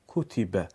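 "کوتیبه" repeated as kutibe, short u, falling; confirming "کوتیبه" kutibe; correct short damma. Shown as a demonstration. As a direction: say "کوتیبه" again, crisp and short. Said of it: "خطاست".

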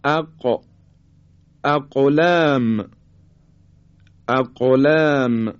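اق with the ق bounced into a short echo; qalqalah on qaf. Shown as a demonstration. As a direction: bounce the still ق in اقلام, slow and deliberate. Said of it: "حرف قاف رو زمانی که ساکن هست دقت کنید".

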